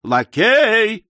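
high shouted call for service; bellowing summons. A narrator's reading. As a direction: holler impatiently for the waiter. Stranger again bellows for a servant.

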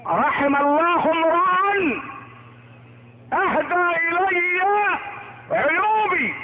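high, drawn-out proclamation in separate phrases; declaimed inscription. Acted. Preacher repeats Omar's door saying to the congregation, declaimed. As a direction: ring out each phrase high and slow, pausing between.

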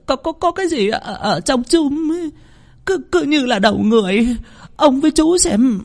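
high stammering terrified voice; stammering in fear. A narrator's reading. Servant woman stammering to the men about the body in the jar. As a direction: stutter out the words, still shaking with fright. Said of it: "lắp bắp".

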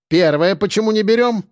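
high-pitched, bossy cook's voice; imperious canteen cook. A narrator's reading. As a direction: demand it like a domineering canteen lady. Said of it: "крупная и властная повариха".

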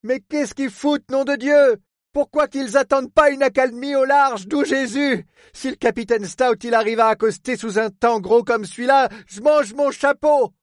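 high, exasperated cursing; incredulous harbour superintendent. Acted. Port man watches the boat attempt docking, exasperated. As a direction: rail at the reckless sailors, building to the end.

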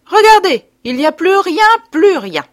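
a high, loud triumphant cry; exclaiming. Acted. Doctor to the disfigured woman, showing her cured face, loud triumphant cry. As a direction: announce it like a little miracle. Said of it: "Puis il s'écria".

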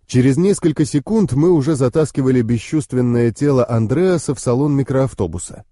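ordinary delivery, nothing marked

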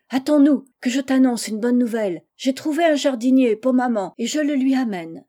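acted eager shout; excited calling out. A narrator's reading. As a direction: shout ahead to a friend, thrilled. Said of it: "cria Félicie".

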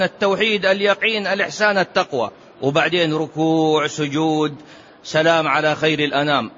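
ordinary delivery

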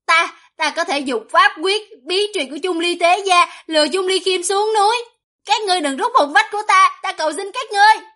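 high-pitched, frantic plea; acted whimpering captive, high and urgent; tearful begging. A narrator's reading. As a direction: rush into desperate pleading as the words pile up. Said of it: "khóc lóc nói".

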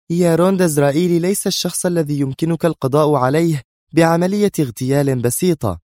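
high, edgy correction; irritable. A narrator's reading. Sonia corrects her bodyguard, irritable. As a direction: explain it high and impatiently. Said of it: "وهي تقول في عصبية".

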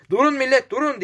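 high-pitched call, mildly shouted; narrator's restrained version of a panting shout; breathless shout. A narrator's reading. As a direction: call out high to halt a crowd. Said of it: "diye bağırıyordu".

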